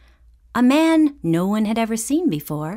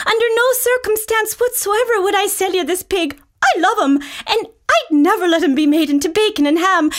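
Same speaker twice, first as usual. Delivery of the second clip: put-on much higher, louder, fast little girl pleading; a small girl refusing to sell her pet; high girlish voice, firm and loving; little girl Annie; 12 semitones higher, 4 dB louder than usual.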